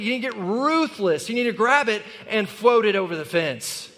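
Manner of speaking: rally the congregation hard; fiery sermon climax; preaching at full force. Acted.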